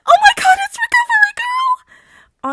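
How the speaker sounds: put-on very high, loud, thrilled child squeal; slow, drawn-out squeal of wonder; excited shriek. A narrator's reading. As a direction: squeal with delight like a starstruck kid. Excited child meets a hero.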